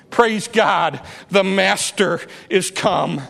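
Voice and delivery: ordinary talk, nothing marked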